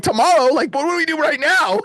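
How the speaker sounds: imitated frantic disbelief; panicked protest. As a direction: act desperate for help right away. Acted desperate plea for help.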